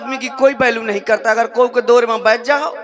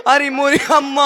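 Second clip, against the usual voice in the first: mock wailing. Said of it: "फिर हम रोने लगे".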